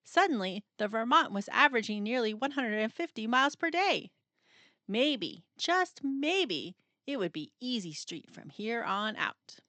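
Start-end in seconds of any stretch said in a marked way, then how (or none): none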